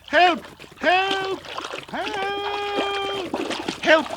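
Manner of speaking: very high squealing bird; cries for help. A narrator's reading. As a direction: shrieks of alarm, one drawn out. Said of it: "cried Peep".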